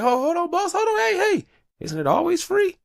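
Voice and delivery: high-pitched, mock-panicked objection; alarmed protest. Host frantically stopping his boss, high alarmed protest.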